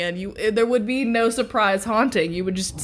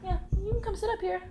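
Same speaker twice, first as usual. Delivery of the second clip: coaxing pet talk; 9 semitones higher, 4 dB quieter than usual. Real, not acted. Owner inviting her dog up beside her, high cooing. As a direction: coo him over softly.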